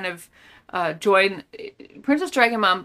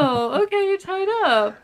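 pitched way up, breezy mock calm; mock nonchalant; 9 semitones higher than usual. Host mocking the girl's calm reaction, mock nonchalant and high. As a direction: shrug it off like nothing's wrong, high. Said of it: "Oh, nonchalant".

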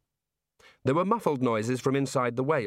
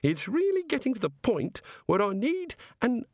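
high, eager voice of the god; high-pitched, fussy god voice; 11 semitones higher than usual. Acted. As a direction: play the chatty, enthusiastic creator god. The god speaks.

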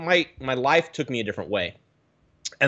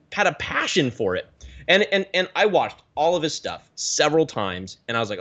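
ordinary delivery

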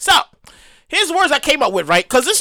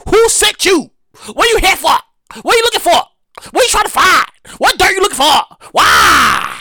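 mock interrogation; 3 semitones higher than usual. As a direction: hammer each question, end on a long cry. Mock interrogation, building to a cry.

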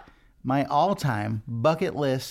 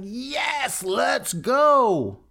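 high, bursting yes, then louder; excited; 11 semitones higher, 4 dB louder, much slower than usual. Retold or imitated. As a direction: jump at the offer like a kid. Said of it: "And I would be so excited".

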